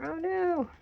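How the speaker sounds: high, loud cry of dismay. Real, not acted. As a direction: exclaim it as if the page is spoiled. Sees markers bled through page.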